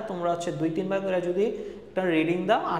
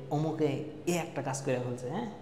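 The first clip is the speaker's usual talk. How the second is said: lightly voiced gossipy aunty, softer and hushed; a hint of a whispering-style gossip, only lightly put on; gossiping neighbour aunty; 3 semitones lower, 7 dB quieter than usual. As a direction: pass it on like a nosy neighbour spreading news, softly. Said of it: "পাশের বাসার আন্টির মতো".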